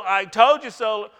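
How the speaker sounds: loud, high sing-song told-you-so; mock told-you-so. Pastor mimicking a smug parent, loud mock scolding. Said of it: "Give them the, the old".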